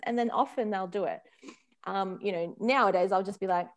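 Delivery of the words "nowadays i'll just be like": usual speaking voice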